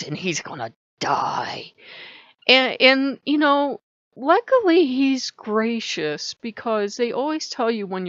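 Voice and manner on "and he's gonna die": slow dark whisper, half joking; menacing whisper. Pretend. To viewers, about Loki, a mock threatening whisper.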